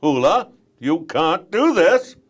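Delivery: high, loud, slow, fussy dad; high, loud, slow dad reminding kids of chores; Doctor Light. Acted. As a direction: scold the kids like a flustered father. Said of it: "he's more of my own voice".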